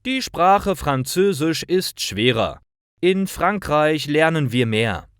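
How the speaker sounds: lightly voiced schoolboy arguing for France; teen boy Martin speaking. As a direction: lift the voice for Martin, keen and persuasive. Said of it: "Martin sagt".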